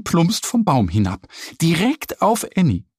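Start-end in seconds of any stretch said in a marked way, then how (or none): none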